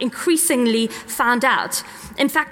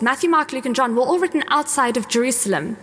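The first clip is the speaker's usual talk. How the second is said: normal talking voice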